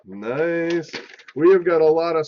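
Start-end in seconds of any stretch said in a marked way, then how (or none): 0.1-0.9 mock French accent.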